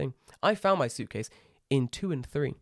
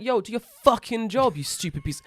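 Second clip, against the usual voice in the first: retold outburst; 7 semitones higher, 3 dB louder than usual. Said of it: "I'm like".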